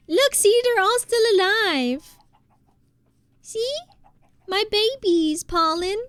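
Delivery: high squeaky voice for her pets. Real, not acted. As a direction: coo at the cows like babies. Showing off her pet cows.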